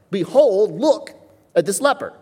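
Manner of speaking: "Behold" and "look" stressed, urgent; emphatic call. Shown as a demonstration. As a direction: point at the leper with your voice. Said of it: "This is very emphatic".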